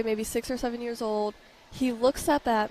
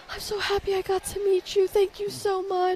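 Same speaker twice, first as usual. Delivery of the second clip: put-on higher, breathless kid gushing thanks; excited little boy; 10 semitones higher than usual. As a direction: play a starstruck little boy. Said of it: "He was like".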